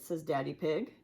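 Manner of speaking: ordinary delivery, nothing marked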